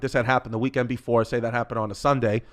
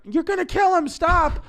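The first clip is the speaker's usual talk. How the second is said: high-pitched mock scream; screaming mother. Mother begs dad to stop the beating, screaming. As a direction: shriek it like a frantic mother, far above your own voice. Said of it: "my mother's screaming".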